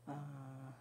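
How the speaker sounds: ordinary delivery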